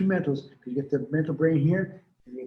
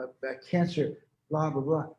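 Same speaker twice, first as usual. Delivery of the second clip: ordinary talk, nothing marked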